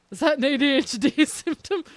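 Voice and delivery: amused disbelief at chat; laughing question. Real, not acted. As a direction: ask it while laughing.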